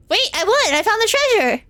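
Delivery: high, thrilled cry of victory; excited. Real, not acted. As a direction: burst out with joy. Wins the game.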